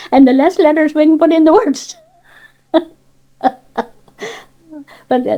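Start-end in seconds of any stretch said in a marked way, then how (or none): 0.1-2.8 louder, higher, breaking out of a laugh; amused. Real, not acted. Joking about Cornish fast speech, amused and louder. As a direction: say it through rising amusement, voice lifted.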